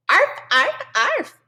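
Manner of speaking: high playful puppy yips; barking. A narrator's reading. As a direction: yap it out like a little dog. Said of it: "barked Jujubee".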